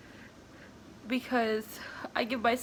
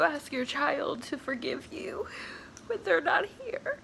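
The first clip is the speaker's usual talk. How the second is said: higher voice, halting through real tears; crying; 5 semitones higher than usual. Real, not acted. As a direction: talk through real crying, pausing to get the words out. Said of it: "I'm sorry for crying".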